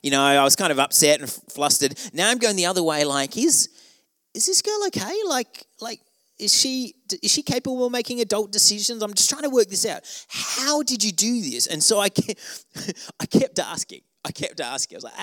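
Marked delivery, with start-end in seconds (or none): 3.4-10.0 very high, incredulous inner questioning; a hammed-up inner voice of total bafflement; baffled disbelief. Retold inner doubt about date. 10.3-11.7 high, slow disbelief; slow baffled question. Retold or imitated.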